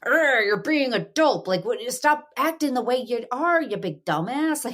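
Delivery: put-on high, slow exasperated mom scolding her kid; exasperated parent scolding. Mom imitating herself scolding her kids, high and exasperated.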